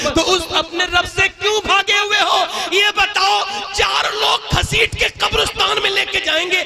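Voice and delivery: fiery preaching. Acted. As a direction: rouse the crowd with a shout. Preaching at full force.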